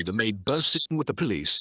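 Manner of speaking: ordinary delivery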